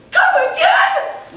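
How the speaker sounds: sudden falsetto yelp, way up high; high-voiced patient's shocked squeak; high-voiced man. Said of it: "he has this unnaturally high voice".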